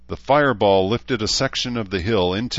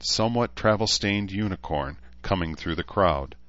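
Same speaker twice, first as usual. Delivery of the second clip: usual speaking voice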